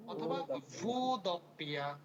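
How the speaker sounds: ভু দপ্পিয়া said slowly, the double p held; slow demo. Shown as a demonstration. Teacher models the letter name slowly for students. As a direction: draw out ভু দপ্পিয়া for the class. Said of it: "কি বলে বলেন তো দেখি".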